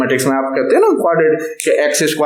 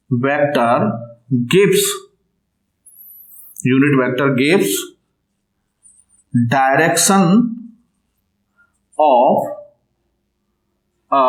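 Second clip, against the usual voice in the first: drawn-out words with long gaps; spaced-out classroom dictation; slow dictation; 2 semitones lower, slower than usual. Real, not acted. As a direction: dictate word by word for note-takers. Dictates point to be written.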